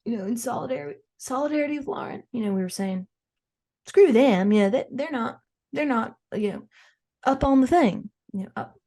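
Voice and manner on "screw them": defiant shout. Retold or imitated. Quoting family's defiant stance.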